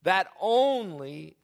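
"only" stretched long for emphasis; stress on ONLY. Real, not acted. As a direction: draw out "only" slowly and firmly. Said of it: "I emphasize the word only".

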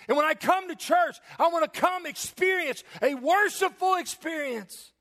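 high-pitched, fervent pulpit cry; impassioned preaching. Real, not acted. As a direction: pour out conviction at full preaching pitch. Preaches at full force.

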